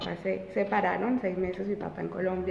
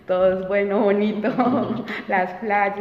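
laughing lightly; 6 dB louder than usual. Real, not acted. Laughing recalling Mexico.

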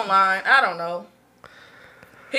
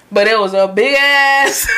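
ordinary talk

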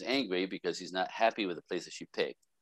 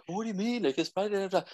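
put-on girlfriend's protest; 9 semitones higher than usual. Girlfriend voiced in retold story.

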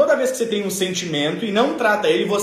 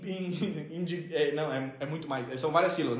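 usual speaking voice

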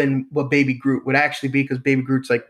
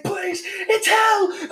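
very high, slow desperate shout; panicked frantic pleading; much slower than usual. Pretend. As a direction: scream for help as dragged away. Robot dragged off screaming.

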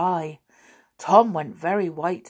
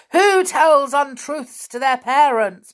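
loud, raised accusing voice; accusing disembodied voice; 9 semitones higher, 10 dB louder than usual. A narrator's reading. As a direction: boom out the accusing question. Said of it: "began the voice again".

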